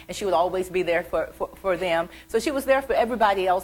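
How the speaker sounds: ordinary delivery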